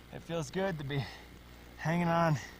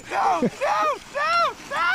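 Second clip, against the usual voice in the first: high hammed-up panic screams; mock screaming; 12 dB louder than usual. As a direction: scream as if sliding in. Acted slide into crevasse.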